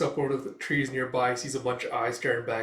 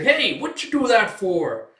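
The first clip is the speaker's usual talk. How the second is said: high, loud, drawn-out complaint; indignant protest; 8 semitones higher, 8 dB louder, slower than usual. Retold or imitated. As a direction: squawk at being mistreated. Dunked sidekick protests.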